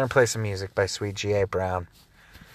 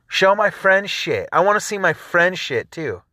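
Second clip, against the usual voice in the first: louder, higher exasperated demand; frustrated rant; 7 semitones higher, 4 dB louder than usual. Real, not acted. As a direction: push it out like a fed-up complaint. Ranting at Facebook.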